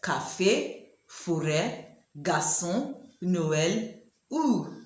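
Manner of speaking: Café, forêt, garçon, Noël, ou said the French way; French words. Shown as a demonstration. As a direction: model each French word. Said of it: "can you pronounce these words".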